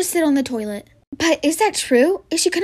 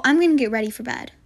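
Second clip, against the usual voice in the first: ordinary talk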